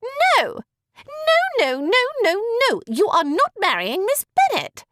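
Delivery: very high shrieked refusal; screeching. A narrator's reading. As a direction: screech no over and over. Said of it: "Miss Bingley screeched out".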